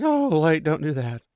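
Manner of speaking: high, loud mock exasperation; dismayed plea. He begs her not to curtsy, dismayed. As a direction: burst out pleading, then ease down.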